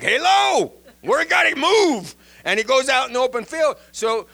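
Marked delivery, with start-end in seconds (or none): 0.0-2.2 high, loud, cartoonish donkey cry; donkey urgently warning Balaam to move, high and loud. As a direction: squeal it like a startled donkey warning its master.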